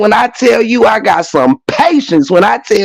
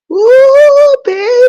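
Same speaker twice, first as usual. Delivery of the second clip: real high squeal; 3 dB louder, much slower than usual. Real, not acted. High exclaim.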